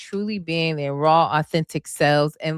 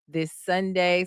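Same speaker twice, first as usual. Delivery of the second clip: ordinary talk, nothing marked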